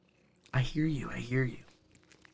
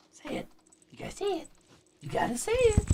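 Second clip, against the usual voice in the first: very high coaxing pet voice; 5 dB quieter, faster than usual. Real, not acted. Coaxing cat to chirp.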